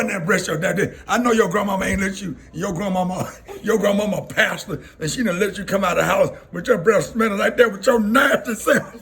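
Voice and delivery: loud comic teacher's telling-off through laughter; mock scolding. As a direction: scold a pupil at full tilt, half laughing. Imitates teacher scolding him, laughing.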